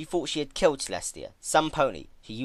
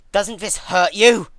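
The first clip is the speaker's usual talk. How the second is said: frustrated demand; 7 semitones higher, 12 dB louder, slower than usual. Acted. Twilight stabs Celestia, gets no reaction.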